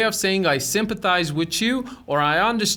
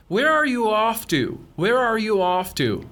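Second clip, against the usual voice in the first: "Where are you off to" gliding down at the end; falling intonation; much slower than usual. Shown as a demonstration. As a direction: let the pitch drop on "off to". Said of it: "Notice the falling intonation".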